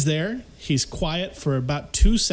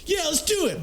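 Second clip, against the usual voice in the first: dumb eager agreement; 12 semitones higher than usual. Retold or imitated. Quoting the other guy.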